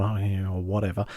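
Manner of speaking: low, soft brush-off; half-hearted mutter imitating someone unimpressed; retold offhand dismissal, voice dropped; dismissive. Retold dismissive reply from patient.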